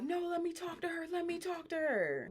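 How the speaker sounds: high-pitched mock yelling, held back in volume. Retelling Sapphire behind the cameras, high mock yell. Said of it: "Sapphire's over there yelling".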